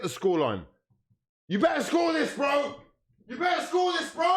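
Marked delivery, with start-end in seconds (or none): 1.5-2.8 will the striker to score; desperate shout. Real, not acted. Fan yelling at his striker to finish the chance, desperate shout. 3.3-4.3 will the striker to score; desperate shout. Real, not acted. Fan yelling at his striker to finish the chance, desperate shout.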